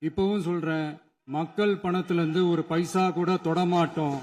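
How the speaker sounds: usual speaking voice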